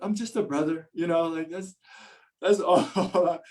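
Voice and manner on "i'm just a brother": higher, meek little "just a brother"; a touch of sheepish modesty; bashful humble voice. Retold or imitated. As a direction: play it shy and modest, light and high. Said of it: "I'm like".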